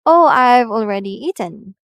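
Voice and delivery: shy, fake assurance; pretending fall-rise. Shown as a demonstration. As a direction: pretend you have eaten. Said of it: "you say".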